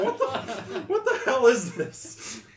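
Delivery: high, slow shocked mom. Retold or imitated. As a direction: squeal it like a stunned mother. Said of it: "that your impression of your mom?".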